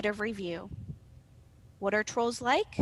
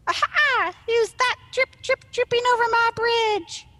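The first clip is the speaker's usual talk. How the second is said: high, loud, squeaky troll challenge; 12 semitones higher, 8 dB louder than usual. Acted. Small troll challenges whoever crosses the bridge, high squeaky voice. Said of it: "a higher, squeakier one".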